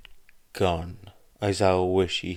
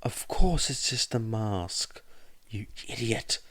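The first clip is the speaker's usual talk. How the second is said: angry exclamation; 3 semitones higher than usual. A narrator's reading. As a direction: spit it at him. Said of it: "exclaimed Midoriya angrily".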